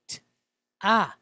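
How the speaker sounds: "t" then "ah" said apart; sounding out syllable. Shown as a demonstration. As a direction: split it into "t" and "ah". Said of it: "that's our vowel, our consonant".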